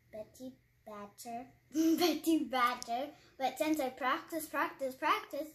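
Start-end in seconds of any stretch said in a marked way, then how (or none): 0.1-3.1 wrong first try. Retold or imitated. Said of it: "first when I said it, I was like".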